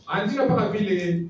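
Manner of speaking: ordinary talk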